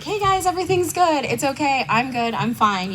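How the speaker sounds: mock breezy reassurance; fake cheerful. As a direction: chirp that all is well, hiding it. Faking being okay to group.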